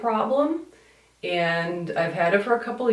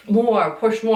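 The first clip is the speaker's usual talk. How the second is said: raised, insistent mock coaching; urging tester; 5 semitones higher, 5 dB louder, slower than usual. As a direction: imitate a tester egging someone on, higher and louder. Imitates tester urging her on.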